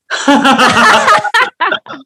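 big high belly laugh; high hearty laugh. Acted. Laughter cream exercise begins.

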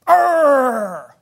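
loud, drawn-out mock growl; hammed-up growl of frustration; angry growl. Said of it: "point at people and you growl".

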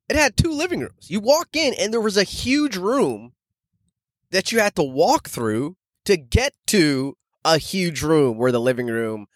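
ordinary delivery

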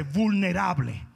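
usual speaking voice

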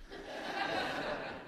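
quiet breathy chuckle; a soft laugh before moving on. Real, not acted. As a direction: let a little laugh slip out.

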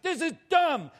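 loud, high, petulant outburst; a sulky yell that it's dumb; tantrum. Retold or imitated. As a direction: throw a grown man's tantrum. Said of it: "I literally threw a tantrum".

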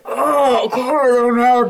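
high, whiny mock disgust; hammed-up gagging reaction; disgusted cops. Cops reacting to the smell of poop, high and whiny mock disgust. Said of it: "these two dudes just like".

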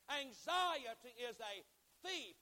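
slow, loud preaching with a high punch on "thief"; loud and clear. Real, not acted. Preacher repeats the key line to congregation, loud and clear. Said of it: "say this loud and clear".

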